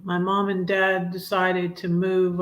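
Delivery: ordinary talk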